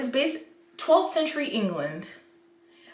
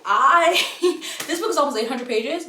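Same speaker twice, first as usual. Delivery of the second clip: louder, higher words through real laughter; laughing; 7 semitones higher, 10 dB louder than usual. Real, not acted. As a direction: laugh as you blurt out the page count. Laughing at the book's size.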